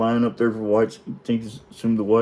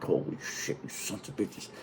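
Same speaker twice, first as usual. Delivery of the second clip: hushed, imitated muttered curse; barely audible retold cursing under the breath; whispered disbelief; 3 semitones lower, 14 dB quieter than usual. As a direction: whisper it as a stunned inner thought. Voicing the kid's silent stunned realisation.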